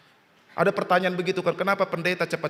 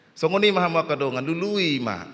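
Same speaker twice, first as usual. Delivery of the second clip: ordinary talk, nothing marked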